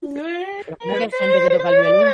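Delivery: incredulous, high and loud; can't believe what she just heard; surprised. Real, not acted. Amazed at funeral violinists.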